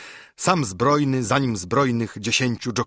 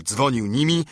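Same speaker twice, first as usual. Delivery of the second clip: usual speaking voice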